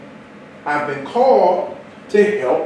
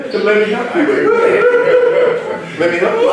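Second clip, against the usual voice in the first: much higher, laughing through the words; giddy laughing offer; 5 dB louder than usual. Real, not acted. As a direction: squeal it out mid-laugh. Still laughing after the teeth joke.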